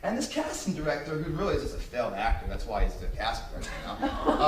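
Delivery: ordinary talk, nothing marked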